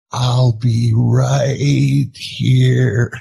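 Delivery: very slow, drawn-out mock farewell; teasing movie impression. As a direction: stretch each word like a movie creature's goodbye. Redoes the tearful line at wife.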